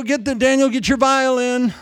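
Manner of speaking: ordinary talk, nothing marked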